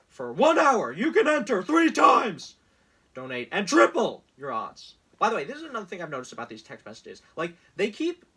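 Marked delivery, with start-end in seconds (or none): 0.1-5.0 loud, pushy sales-pitch voice; mock campaign ad announcer; hammed-up ad read. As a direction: sell it like a cheesy infomercial. Reading Trump campaign text aloud.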